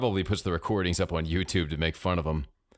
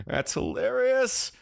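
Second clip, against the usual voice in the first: high-pitched, drawn-out laughing delivery; laugh, then squeal it out slowly; amused laughter; slower than usual. A narrator's reading. Samir laughs at radio story.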